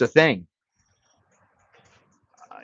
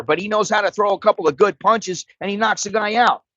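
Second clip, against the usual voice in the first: ordinary delivery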